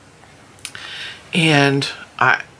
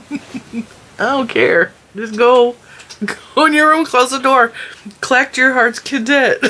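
laughing dismissal; 8 semitones higher, 3 dB louder than usual. Retold or imitated. Laughing, shooing son away.

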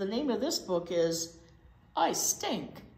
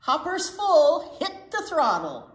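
loud slow bossy order; loud command; 8 semitones higher, 9 dB louder, slower than usual. Acted. Reading to kids, the truck loudly orders more gas. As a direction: boom it out like a boss.

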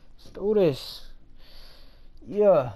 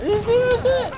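excited; 7 dB louder than usual. Real, not acted. Reacting to glitch in game.

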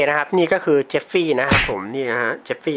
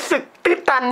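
touched laugh; 11 semitones higher, faster than usual. Real, not acted. Touched that friend gave money.

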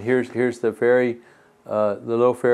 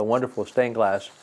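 plain reading voice

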